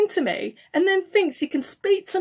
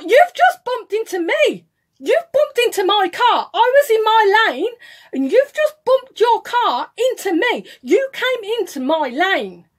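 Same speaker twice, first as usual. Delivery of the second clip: raised, higher-pitched protest; indignant retort; 6 semitones higher, 5 dB louder than usual. Retold or imitated. Telling the other driver he bumped into her car, indignant. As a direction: argue back indignantly, voice up.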